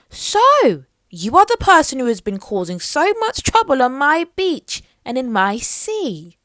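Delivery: loud, high royal scolding; angry queen. Acted. Mermaid Queen scolding Little Miss Trouble: angry royal rebuke. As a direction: tell off the troublemaker from the throne. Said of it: "said the Mermaid Queen angrily".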